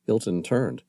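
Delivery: ordinary delivery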